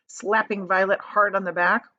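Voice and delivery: plain reading voice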